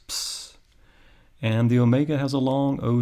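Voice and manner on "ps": "ps" as a bare voiceless cluster, like the end of hoops; isolated soft "ps", p running into s; double consonant sound. Shown as a demonstration. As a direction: sound out "ps" without any vowel. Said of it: "like the end of hoops".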